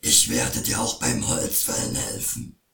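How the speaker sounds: very low troll promise; eager to please, deep as a troll. Acted. Troll voice continues.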